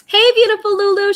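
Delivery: high, loud sing-song hello; bright high "Hey, beautiful" to a fan; excited greeting. Real, not acted.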